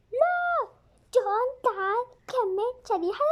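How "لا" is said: put-on high sharp no from a stubborn child; shrill refusal. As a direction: refuse like a defiant kid. (رفض حاد)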